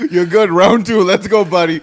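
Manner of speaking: loud, high pep shout; mock cornerman. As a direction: hype up a fighter between rounds. Mock cornerman cheering on fighter.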